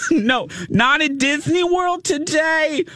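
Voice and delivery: very high, slow squeaky quip; screechy Marianne. Retold or imitated. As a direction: drawl it in a high doting fan voice. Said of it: "a really screechy voice".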